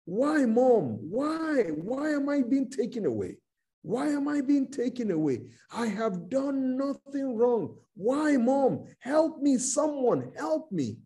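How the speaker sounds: high, anguished begging; crying plea. Acted. Preacher acts out boys about to be hanged, crying and pleading. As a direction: cry out for mother's help, pitched well up. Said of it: "Those boys were crying".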